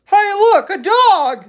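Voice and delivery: put-on high, loud boy calling out; excited boy. A narrator's reading. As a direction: point and shout like a kid. Boy calls out in story.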